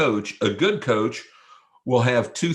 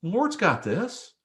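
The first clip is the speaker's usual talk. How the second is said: reassuring friend; 8 semitones higher than usual. Retold or imitated. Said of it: "tap you and say".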